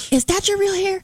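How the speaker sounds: high-pitched mock curiosity; mimicked nosy question. Coworker asking every single time, mimicked nosiness.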